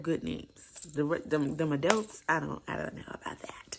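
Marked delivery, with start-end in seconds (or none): 2.3-3.8 a doubtful aside, whispered under her breath. Real, not acted. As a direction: drop to a secret whisper for the doubt. Doubtful aside about adult names.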